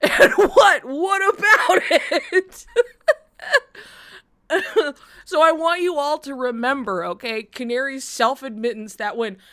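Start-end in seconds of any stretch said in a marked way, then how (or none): 0.0-5.0 slow, high, laughing question; bewildered laugh. Real, not acted. Asking the group in bewilderment, laughing.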